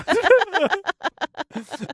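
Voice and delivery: high burst of giggles; laughing. Real, not acted. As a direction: crack up at your own brag. Laughing at own brag.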